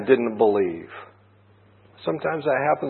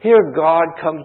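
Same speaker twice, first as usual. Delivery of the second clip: ordinary talk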